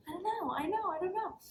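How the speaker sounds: high, helpless little whine; flustered whine. Retold or imitated. Answering boyfriend about her moods.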